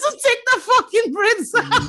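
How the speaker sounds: high, loud, laughing it out; laughing through the punchline. Real, not acted. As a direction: crack up while saying it. Laughing at own story.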